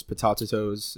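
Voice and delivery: "potatoes" as puh-TAH-tuh-toes; stumbling joke "potatoes", puh-tah-tuh-toes; garbled pronunciation. Shown as a demonstration. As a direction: add an extra syllable to "potatoes".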